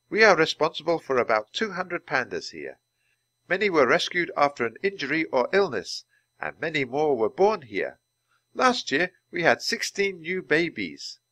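a hint of a polite guide addressing the group; lightly voiced Dr. Ling. A narrator's reading. Doctor Ling explains.

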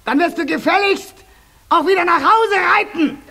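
building to a high, loud, fast shout; furious shout. Acted. Chief witch chasing the little witch away, rising furious shout. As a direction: drive her out furiously.

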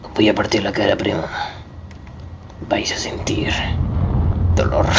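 hushed mock threat; menacing whisper.

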